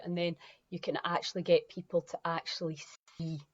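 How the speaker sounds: normal talking voice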